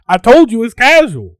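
high, loud Southern scolding, imitated; southern accent. Groom scolds overdressed guest, Southern accent. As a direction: holler it in a mock Southern accent. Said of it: "of course in his southern accent".

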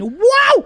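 high, loud yelp of "wow"; comic high-pitched exclamation; startled wow. Retold or imitated. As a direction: burst out in a sudden high cry. Acting out the bedroom moment.

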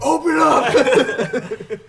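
high, loud and very slow through laughter; laughing mock shout. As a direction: bellow it like a cop at the door while cracking up. Laughing joke command after Ohio.

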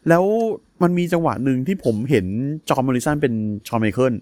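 ordinary talk

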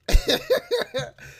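big high burst of laughter; loud high laugh. Real, not acted. Friend cracks up at the snake slobber story, loud high laugh.

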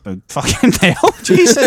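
can't believe what he just heard; shocked disbelief. Real, not acted. Shocked that Danny went twice.

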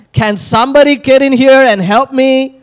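high, loud call from the kitchen; fed-up mother calling. Retold or imitated. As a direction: yell for help like an overworked mom. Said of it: "heard this dialogue from your mothers".

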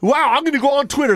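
fast, high-pitched huffy threat; mock indignant heckler. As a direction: imitate an offended customer. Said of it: "This is what they tweet out".

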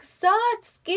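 very high, drawn-out plaintive call; mock child's wail, stretching the name; little girl's cry. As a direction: call out like a tiny lost sister. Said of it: "it's so sad ... she's so little".

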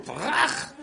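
a high, laughing crash noise for a punch; smack sound. Retold or imitated. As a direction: blurt out a cartoon smack. Big brother hits little brother. (קול מכה צוחק וגבוה)

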